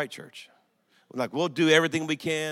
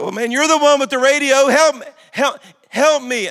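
loud, higher, exasperated shout; angry demand; 10 semitones higher, 11 dB louder than usual. Retold or imitated. Father yells at a security guard who won't help search, loud and angry. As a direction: bark at the useless guard for help.

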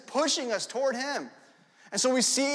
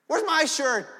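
put-on envious kid; 6 semitones higher, 4 dB louder, slower than usual. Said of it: "you're looking at them like".

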